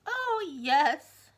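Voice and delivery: loud, high moaned reply; moaning. Acted. Mister Greedy to the giant, moaning. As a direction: moan it miserably. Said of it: "moaned Mister Greedy".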